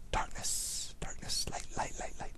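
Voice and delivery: soft voiceless chant of darkness and light; breathy spooky murmur; eerie whisper. Acted. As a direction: whisper it like an ominous incantation.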